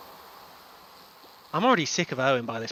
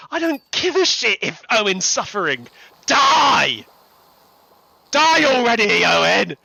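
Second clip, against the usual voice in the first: high, loud rant building to a full shout; furious rant; 9 semitones higher, 11 dB louder than usual. Real, not acted. Fan wishing hated Owen would die, furious shouting. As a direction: build to yelling at the top of the voice. Ranting against a hated character.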